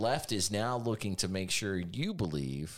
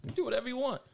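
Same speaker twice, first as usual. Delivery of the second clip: mocking voice; much faster than usual. Mocking tech company stance.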